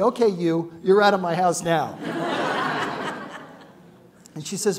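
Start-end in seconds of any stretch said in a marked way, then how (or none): 0.0-2.0 loud, laughing mock order to a guest; mock eviction. Wife telling the lingering guest to leave, laughing loudly. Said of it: "I would say". 2.0-3.6 high, breathy burst of laughter. Real, not acted. Speaker laughing at the wife's story, a real burst of laughter.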